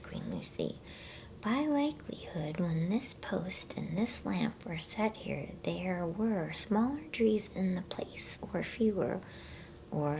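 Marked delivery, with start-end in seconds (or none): none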